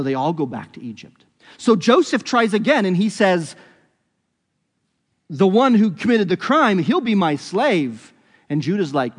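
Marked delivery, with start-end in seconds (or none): 5.3-8.1 louder, higher pronouncement; stern demand. Acted. Joseph to the brothers, a loud stern demand. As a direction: lay down the verdict like a ruler.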